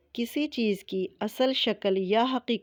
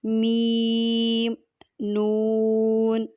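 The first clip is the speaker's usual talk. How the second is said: each letter name drawn out very slowly; spelling recitation; much slower than usual. Shown as a demonstration. Teacher spelling letters aloud for students, slow and drawn out. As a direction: chant the letters slowly one by one. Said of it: "اب اس کے ہم ہجے کیسے کریں گے؟".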